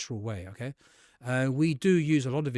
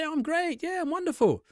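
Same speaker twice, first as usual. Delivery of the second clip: much higher, bright burst of enthusiasm; enthusiastic answer. Shown as a demonstration. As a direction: sell how great you feel. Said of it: "So let's say, for example".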